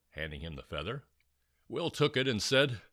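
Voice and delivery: ordinary delivery, nothing marked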